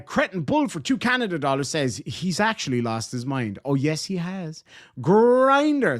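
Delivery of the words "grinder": excited callout. Real, not acted. Big super chat arrives.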